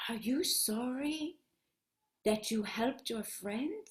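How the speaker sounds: very soft, measured question; gentle moon. Acted. Moon asks Hannah about helping her friend, soft and gentle. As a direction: ask tenderly in a hushed voice.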